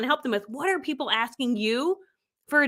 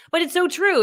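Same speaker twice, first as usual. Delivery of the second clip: ordinary talk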